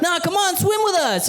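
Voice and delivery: put-on high-pitched excited kids begging; squeaky kid voices calling from the pool; kids' voices. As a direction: voice the little kids pleading.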